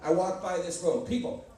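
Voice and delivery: usual speaking voice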